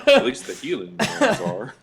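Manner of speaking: talking through laughter; laughing. Real, not acted. Laughing while speaking.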